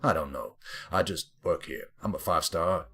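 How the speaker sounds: lightly put-on, bad American accent; a hammed-up American general, mostly low; awful American accent. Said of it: "had to sit through that awful accent".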